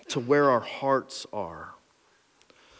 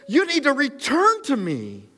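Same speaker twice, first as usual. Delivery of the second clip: forceful plea; 11 semitones higher, 4 dB louder than usual. Acted. God's plea, preached at full force.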